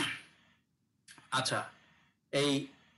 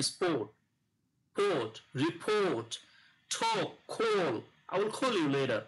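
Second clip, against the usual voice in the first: English model pronunciation; slower than usual. Shown as a demonstration. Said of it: "উচ্চারণ করবে না".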